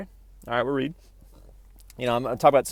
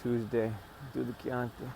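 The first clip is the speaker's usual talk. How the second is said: Godfather movie quote; 9 dB quieter than usual. Retold or imitated. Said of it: "Remember in The Godfather, he's like".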